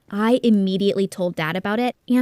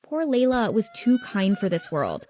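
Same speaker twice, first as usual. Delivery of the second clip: ordinary delivery, nothing marked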